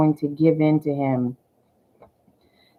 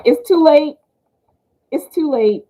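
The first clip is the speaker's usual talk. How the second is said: higher, louder mock brush-off; a hammed-up refusal, higher and louder; defiant retort; 9 semitones higher, 7 dB louder than usual. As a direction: shut him down, playful and loud. Acted retort to smooth talker.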